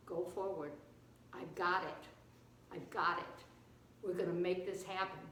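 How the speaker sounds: low, measured and assured; calm reassurance. Acted. God telling Moses He has it in hand, calm reassurance. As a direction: settle him quietly, short phrases with pauses.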